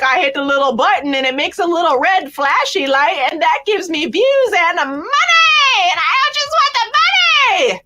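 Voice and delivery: mock her as a money-hungry vlogger; mocking high Ruby voice. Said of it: "my voice went up so high there".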